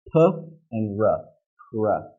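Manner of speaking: P and R apart, then fused as PR; consonant blending. Shown as a demonstration. Teacher builds the cluster for learners, consonants blended. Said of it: "putting two consonants together".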